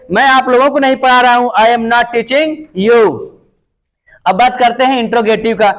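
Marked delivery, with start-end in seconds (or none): 1.6-3.1 each word slow and spaced; teacher spelling out a sentence; slow dictation. Shown as a demonstration. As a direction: dictate it slowly for the class. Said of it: "slow dictation of English".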